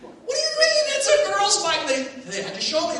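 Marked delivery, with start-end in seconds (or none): 0.0-1.8 much higher, fast incredulous question; disbelieving kid. Retold or imitated. Boy demanding an explanation, high fast disbelief. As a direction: blurt it in disbelief. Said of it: "I go".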